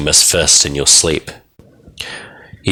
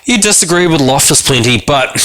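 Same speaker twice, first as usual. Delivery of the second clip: pitched up; 11 semitones higher than usual. A narrator's reading. Narration suddenly pitched up.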